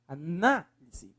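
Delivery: "análise" slowly, leaning on NA; stressed syllable. Shown as a demonstration. Teacher showing the strongest syllable, stress on NA in "análise". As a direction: draw out the NA in "análise". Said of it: "Qual é a sílaba mais forte?".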